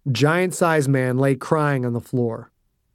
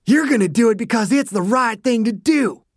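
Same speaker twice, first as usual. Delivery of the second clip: higher, raging bully voice; angry shout; 8 semitones higher than usual. Acted. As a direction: lecture him in fury. Dew raging at Dossie.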